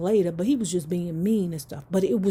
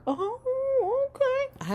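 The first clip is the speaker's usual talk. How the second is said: squeaky surprised; much slower than usual. Retold or imitated.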